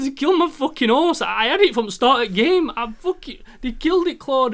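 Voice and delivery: high, loud upset whine; half laugh, half cry it; mock sobbing complaint. Telling his girlfriend about the horse, high upset whine. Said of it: "I goes".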